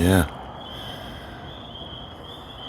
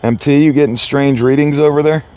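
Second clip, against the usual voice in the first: calling out; 9 semitones higher, 8 dB louder than usual. Pretend. Calling out to teammate.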